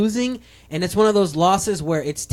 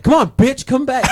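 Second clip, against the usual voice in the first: lightly acted taunt, pitched up; retold smack talk; 6 semitones higher, 3 dB louder than usual. Friend quoting the trash talk to his rival, taunting. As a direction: goad the opponent, half-playing it.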